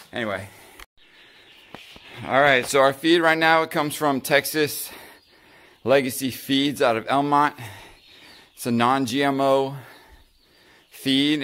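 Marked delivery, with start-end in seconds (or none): none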